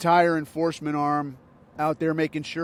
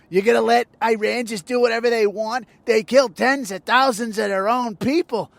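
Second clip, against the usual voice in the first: higher, louder whiny mock pundit voice; mock whining complaint; 6 semitones higher, 5 dB louder than usual. Mocking Shapiro and Levine complaining about Iran, in a high whiny voice. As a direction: parody the pundits' pleading. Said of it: "I don't wanna hear your complaints".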